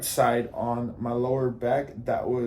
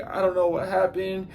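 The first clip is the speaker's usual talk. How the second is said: higher-pitched imitation of tearful wailing; mock hysterical crying; 6 semitones higher than usual. Driver pleading confusion after the crash, mock hysterical crying. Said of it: "she starts hysterically crying and crying".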